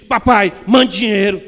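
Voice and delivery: father reads it as a cold order; curt demand. Retold or imitated. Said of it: "não está escrito assim".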